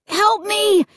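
a little brother crying out, lightly voiced; shriek. A narrator's reading. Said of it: "I heard Luke shriek".

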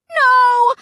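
high, loud desperate cry. A narrator's reading. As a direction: yell it to save your brother. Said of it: "I cried".

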